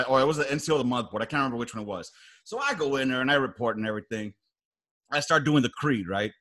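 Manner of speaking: ordinary delivery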